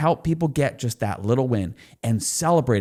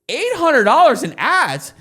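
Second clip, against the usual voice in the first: a much higher, louder and slower mock disbelief; incredulous wife; 10 semitones higher, 6 dB louder than usual. Husband mimicking his wife hearing the ad spend, high incredulous. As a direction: imitate the wife repeating the figure in shock.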